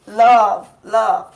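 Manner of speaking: loud, punched word "Love"; hammered word. Real, not acted. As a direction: drive "Love" home loud.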